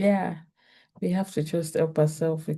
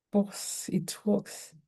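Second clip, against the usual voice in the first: whispered pause; 3 semitones higher, 8 dB quieter, much slower than usual. Shown as a demonstration. Showing the calm pause.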